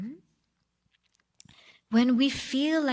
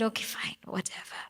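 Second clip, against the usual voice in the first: softer, unvoiced shrug of a line; reluctant whisper; 7 dB quieter, faster than usual. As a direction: give in with a sigh, barely saying it. Sun gives in reluctantly.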